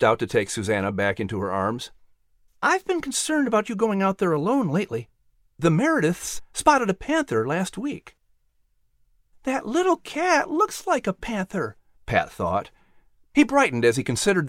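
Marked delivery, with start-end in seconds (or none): 2.6-8.1 a hint of a mother's concern; mother warning her son about a panther; mildly worried mother. 9.4-11.8 put-on high, bright young boy's voice; a keen son offering to help; boy asking his mother eagerly; eager boy Patrick. Patrick thinks.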